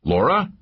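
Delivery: "Laura" rising high at the end; "Laura" with an anxious upward glide; worried question. A narrator's reading. Worried check on injured companion.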